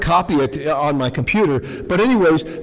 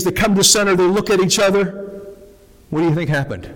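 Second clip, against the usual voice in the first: usual speaking voice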